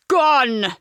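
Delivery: loud, booming exclamation; loud exclaiming. A narrator's reading. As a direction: call it across the room. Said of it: "a voice echoed out across the room".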